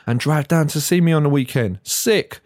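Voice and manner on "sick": mock excited.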